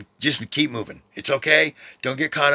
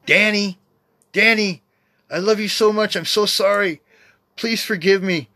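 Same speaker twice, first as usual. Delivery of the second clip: higher and louder, pleading apology; brother's voice from beyond; 9 semitones higher, 5 dB louder, slower than usual. Retold or imitated. Dead brother asking Danny to forgive him, higher and louder plea. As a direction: call his name twice, then beg forgiveness. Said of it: "distinctly heard this".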